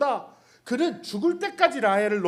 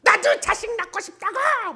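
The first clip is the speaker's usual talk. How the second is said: much higher, shrill-pitched mock nagging cry; nagging wife; 12 semitones higher, 4 dB louder than usual. Rachel nagging Jacob for children, high mock cry. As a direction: whine at him like a jealous wife. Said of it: "야곱의 바가지를 긁는".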